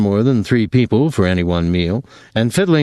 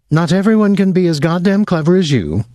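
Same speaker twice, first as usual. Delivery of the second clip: bitter outburst; 9 semitones higher than usual. Acted. Gretchen snapping at her tutor, bitterly. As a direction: lash out at him in frustration.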